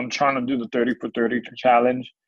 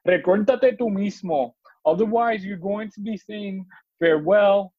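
much higher, animated scolding recital; spoken-word poem; 10 semitones higher than usual. Acted. Poet telling himself to get it together, animated scolding. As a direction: perform it as a rhythmic, exasperated pep talk.

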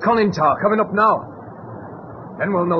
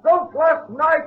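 shouting across; 6 semitones higher than usual. Acted. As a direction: call out to be heard on the sub. Hailing the submarine across water.

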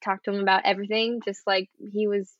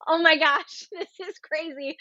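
overwhelmed teary laugh; laughing through tears; 8 semitones higher than usual. Retold or imitated. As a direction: burst out in teary joy. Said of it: "I was like".

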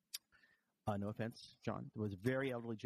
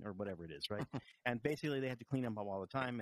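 ordinary delivery

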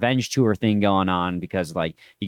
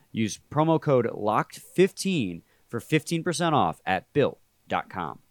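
ad read. Acted. Host reads sponsor ad.

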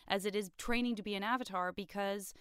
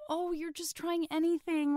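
higher, sympathetic sigh of a line; consoling Aang, voice raised; pitying; 8 semitones higher than usual. Retold or imitated. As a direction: say it like gently consoling. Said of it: "It's like".

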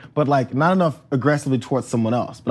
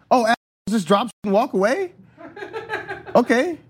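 higher, mock-innocent sarcastic jab; sweetly passive aggressive; 9 semitones higher than usual. Shown as a demonstration. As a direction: fake surprise aimed at him. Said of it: "that's a card-carrying move".

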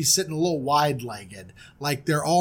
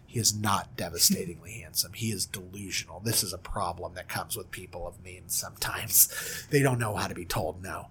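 low, soft near-whisper; deadpan undercutting aside, much lower and softer; hushed aside; 9 semitones lower, 8 dB quieter than usual. Real, not acted. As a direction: confide it to the listeners under your breath. Narrator's hushed aside undercutting the boast.

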